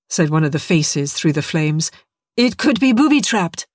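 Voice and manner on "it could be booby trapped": sharp, higher warning from a stranger; a wary survivor's quick warning; urgent warning. Acted. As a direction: stop him before he touches it. Survivor warns from the fire.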